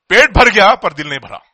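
big loud burst in Hindi; loud exclamation. Real, not acted. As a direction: shout it with delight.

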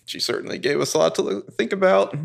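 mock fluster that rises to a squeak on ton; flustered Ezra. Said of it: "he's like".

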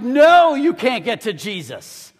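sudden loud, high mock yell. Disciples turn parents away from Jesus, yelling. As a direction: yell it like a parent scolding children. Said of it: "they started yelling at them".

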